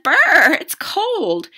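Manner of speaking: high, loud "brr" and cry; freezing girl. A narrator's reading. Girl just out of icy water, freezing. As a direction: shiver the brr, then pipe up high.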